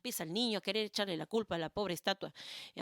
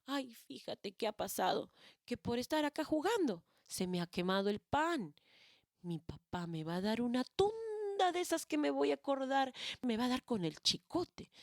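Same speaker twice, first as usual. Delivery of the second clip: put-on frightened child pleading; upset crying child; 6 semitones higher than usual. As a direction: whimper it out, scared of his father. Friend crying over burnt bread.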